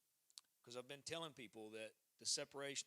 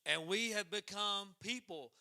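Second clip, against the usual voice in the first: shouted out, much louder and higher; rousing shout; 5 semitones higher, 18 dB louder than usual. Real, not acted. Supporter rousing the barn church crowd, shouting. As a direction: preach it at full force to the crowd.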